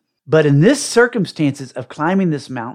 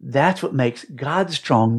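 softer, gentle little voice in the ear; retelling God's quiet reassurance, spoken softly; soft inner voice; 3 semitones lower, 5 dB quieter than usual. Retold or imitated. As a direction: speak it softly like a voice in your ear. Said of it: "a little voice in my ear".

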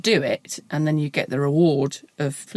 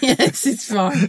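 mock reassuring; 4 semitones higher, much slower than usual. Cheery reassurance bit.

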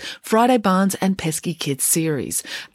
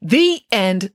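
ordinary delivery, nothing marked

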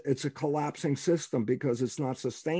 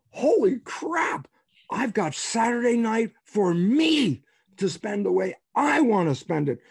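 higher, drawn-out burst of amazed delight; excited realization; 7 semitones higher, 4 dB louder than usual. Acted. Man suddenly realizing his Saturday night is free, excited. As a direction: let the revelation burst out high.

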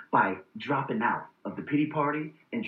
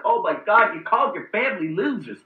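mock outrage; 6 semitones higher, 5 dB louder than usual. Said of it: "a lot of people are like".

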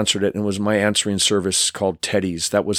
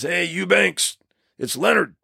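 higher, gravelly macho stunt-legend voice; a loose take on a gruff tough guy; imitating Terry Leonard; 8 semitones higher than usual. Stunt coordinator calling the narrator, gravelly macho voice. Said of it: "he's got this gravelly voice".

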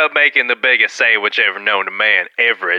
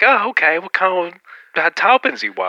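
higher-pitched, hesitant server voice; imitated sandwich worker; 6 semitones higher than usual. Sandwich worker asking about toppings, imitated higher voice. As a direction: play the server, pitched up and fumbling.

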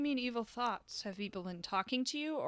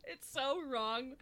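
put-on Russian accent; 3 semitones higher, slower than usual. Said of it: "with a Russian accent".

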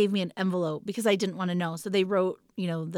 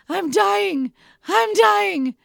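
much higher, louder cry of despair; dramatic labor cry; 11 semitones higher, 6 dB louder, much slower than usual. Retold or imitated. Woman in labor crying out while pushing, high loud wail. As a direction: wail it like you're really dying.

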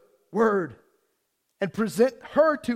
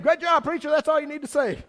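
high, louder teasing voice; mock church musician; 7 semitones higher, 5 dB louder than usual. Preacher imitating musician wrapping up, teasing. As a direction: tease it like an eager musician.

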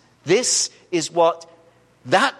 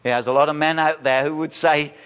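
ordinary delivery

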